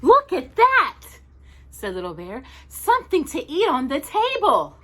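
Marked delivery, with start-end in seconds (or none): 0.0-1.0 much higher, louder little bear. Acted. Little Bear finds a house on the moon, excited high voice. 2.7-4.8 higher little bear, eager. Acted. Little Bear spots lunch, eager higher voice.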